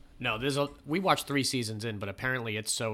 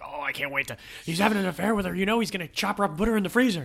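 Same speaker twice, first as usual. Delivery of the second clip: higher, louder imitation of a hooked viewer; excited gossip, rushing faster toward the end; mock excited viewer; 8 semitones higher, 4 dB louder, faster than usual. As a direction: play a true-crime fan gripped by the story. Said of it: "and you're like".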